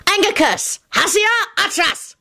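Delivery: high, triumphant spell cry; triumphant scream. A narrator's reading. Scully casts his curse, screaming triumphantly. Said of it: "he screamed triumphantly".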